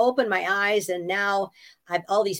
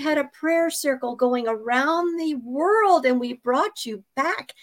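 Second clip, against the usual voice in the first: higher, slow earnest mother voice; imitating her mother; 7 semitones higher than usual. Mother announcing her prayer circle, higher and slow. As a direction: pipe it up slowly like a proud mother. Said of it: "my mother pipes up with".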